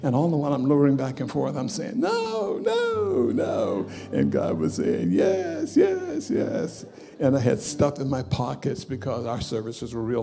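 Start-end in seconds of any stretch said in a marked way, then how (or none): 2.0-3.9 high, loud pleading no, no, no; a frantic high refusal, each no climbing; crying out no. Retold or imitated. Young man on the floor resisting God, high pleading cries. 5.1-6.9 voice of God insisting. Retold or imitated. God answering his refusal.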